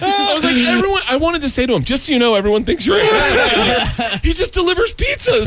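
high, laughing through the words; can't stop cracking up as you tell it; real laughter breaking into the story; laughing while telling. Real, not acted.

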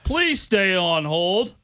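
very slow mocking imitation; exaggerated slow-talker impression; mimicking co-worker. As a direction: stretch it out like a plodding phone answerer. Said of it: "That's how you sound".

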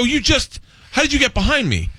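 high, incredulous questioning; baffled dad. Acted. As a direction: act out a dad demanding an explanation. Startled dad confronting his daughter.

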